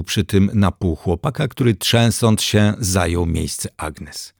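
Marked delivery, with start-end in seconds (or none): none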